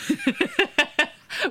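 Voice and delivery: bright burst of laughter. Real, not acted. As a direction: laugh out loud, delighted. Laughs at guest joke.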